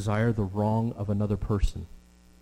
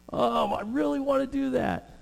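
longing wish. Retold or imitated. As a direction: stretch it up high like a wishful kid. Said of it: "you're just like".

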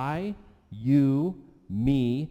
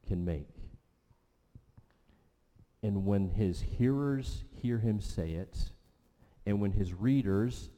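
ordinary talk

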